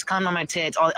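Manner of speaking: normal talking voice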